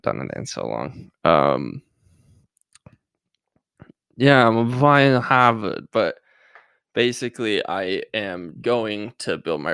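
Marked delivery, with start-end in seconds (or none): 4.2-5.9 Boston accent attempt. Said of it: "I can't do a Boston accent yet".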